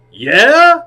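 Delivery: loud, very high rising query; Al Pacino. Acted. Star presses the clown harder, loud high questioning impression. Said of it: "He said".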